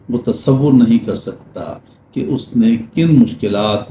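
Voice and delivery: ordinary talk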